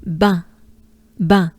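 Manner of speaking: Bain said with a nasal French vowel, no final n. Shown as a demonstration. Teacher modelling French bain for learners. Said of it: "So again".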